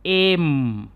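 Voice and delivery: एम said as a clean "em", no y glide; teacher models एम as "em" for the class; correct em. Shown as a demonstration. Teacher shows students the right sound, clear "em". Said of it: "एम का उच्चारण ये है".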